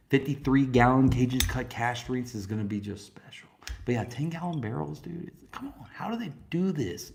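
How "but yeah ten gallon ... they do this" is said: awed whisper, higher pitched; hushed amazed aside. Real, not acted. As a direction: whisper it in disbelief. Whispered amazement about barrels.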